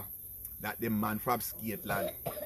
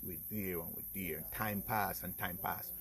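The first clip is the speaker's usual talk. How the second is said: ordinary talk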